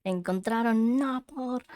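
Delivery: loud disbelieving echo; stunned repeat, voice jumping up; surprised. Real, not acted. Shocked echo of video.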